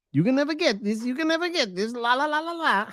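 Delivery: put-on high, teasing childish sing-song ending in la la; sing-song taunt. Reading a viewer's taunt at [name] in sing-song. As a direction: taunt like a kid on a playground. Taunting super chat read aloud.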